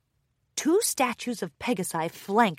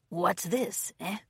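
gruff pirate voice; 2 semitones lower, 9 dB quieter, slower than usual. Acted. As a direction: mutter like a greedy pirate. Pirate finds something shiny.

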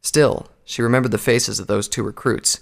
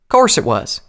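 louder, higher, affronted reply; indignant; 4 semitones higher, 6 dB louder than usual. Acted. Applejack defends her own honesty, indignant. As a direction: answer back as if insulted. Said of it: "Applejack answered indignantly".

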